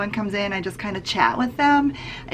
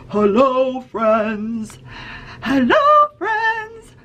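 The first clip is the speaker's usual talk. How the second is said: different silly voices; much slower than usual. Shown as a demonstration. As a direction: play with funny voices for the kids. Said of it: "we do different voices".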